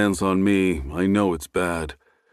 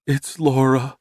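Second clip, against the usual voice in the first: higher, choked with tears; choked sobbing; 6 semitones higher than usual. A narrator's reading. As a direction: break down as you say the name. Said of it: "Bethany's choked voice or her sobs".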